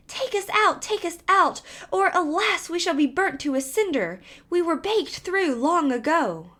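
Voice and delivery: high, pleading little bread voices; crying loaves. A narrator's reading. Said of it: "cried the loaves as before".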